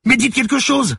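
very high, loud scream, beside herself; frantic shout. A narrator's reading. Manon beside herself at Lucie's silence, frantic shout. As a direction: shriek it, demanding an answer. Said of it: "s'écria Manon hors d'elle".

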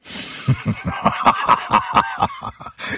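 a vile, rising cackle; ignoble laugh. A narrator's reading. Killer mocks the begging brothers, vile cackle. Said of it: "dégorgea un rire ignoble".

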